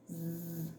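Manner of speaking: very soft sustained "zzz"; buzzing Z sound. Shown as a demonstration. Teacher contrasting Z with S, soft buzzing zzz. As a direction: hum a quiet voiced Z.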